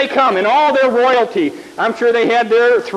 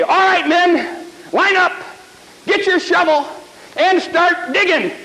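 commanding kings; 7 semitones higher than usual. Acted. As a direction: call the troops into line. Kings order the soldiers.

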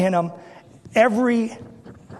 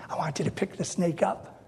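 softer, hushed instruction; 3 semitones lower, 10 dB quieter than usual. Acted. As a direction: lean in and say it low. God whispers instruction to Moses.

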